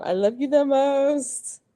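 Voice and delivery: high, slow and tender; doting mom. Retold or imitated. Mother repeating what she tells her son, slow and doting. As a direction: coo it like a proud mama. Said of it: "That's what I say all the time".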